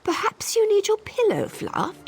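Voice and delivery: mock servile sarcasm. Disguised servant teasing a pampered visitor, mock servile sarcasm. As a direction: simper the offer of help, dripping with scorn.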